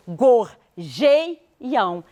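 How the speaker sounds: gorjeiam slowly split into gor, jei, am; syllable by syllable. Shown as a demonstration. Teacher explaining where the count stops, syllable by syllable. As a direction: say gorjeiam in separate, stretched syllables.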